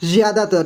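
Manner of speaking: ज़्यादातर starting with a buzzed z; dotted z sound. Shown as a demonstration. Teacher modelling the dotted letter in ज़्यादातर, z sound. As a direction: say ज़्यादातर as zi-yaa-daa-tar. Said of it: "इस प्रकार से sound आना चाहिए".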